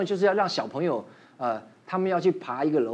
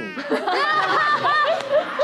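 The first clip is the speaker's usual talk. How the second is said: high laughing burst. Real, not acted. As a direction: laugh out high and bright at the memory. Laughs at fathers racing hard. (高声大笑)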